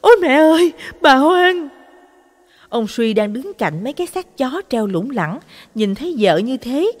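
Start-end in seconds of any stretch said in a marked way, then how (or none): 0.0-1.7 much higher, much louder shriek of fright; terrified scream. A narrator's reading. Wife finding the corpse in the pot, terrified scream. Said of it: "ú ớ hét lên".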